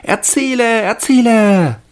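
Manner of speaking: put-on high, loud excited children's cry; children calling. A narrator's reading. Children clap and urge the man, high excited calling.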